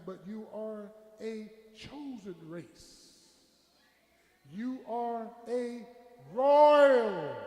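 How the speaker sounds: very slow, declaimed verse with long-held key words; stately, stretched pulpit reading; preacher's scripture reading. Real, not acted. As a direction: draw out each phrase, swelling on the big words.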